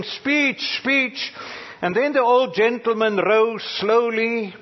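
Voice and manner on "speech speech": mock crowd yell. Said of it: "they kept yelling".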